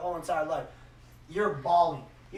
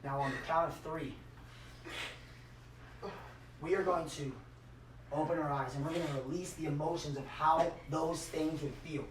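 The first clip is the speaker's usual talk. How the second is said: guided visualization; 4 semitones lower, 8 dB quieter than usual. Acted. Coach guiding the crowd through a visualization, softly. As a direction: talk them softly into the feeling.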